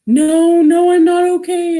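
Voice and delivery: loud, high-pitched distraught wail, retold; re-enacted tearful outburst; crying. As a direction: act out crying down the phone, voice pushed up high.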